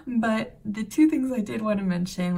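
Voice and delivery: ordinary talk, nothing marked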